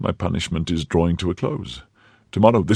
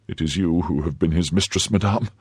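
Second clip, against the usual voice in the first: ordinary delivery, nothing marked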